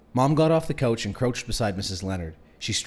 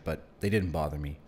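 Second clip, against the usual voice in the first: ordinary delivery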